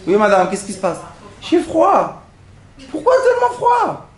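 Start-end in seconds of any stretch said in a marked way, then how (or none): 1.4-4.1 high, whiny complaining voice; whiny complaint. Retold or imitated. Rabbi imitating a woman on the plane whining about the cold, high and whiny.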